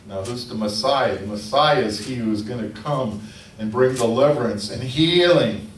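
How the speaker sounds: ordinary talk, nothing marked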